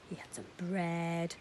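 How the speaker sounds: usual speaking voice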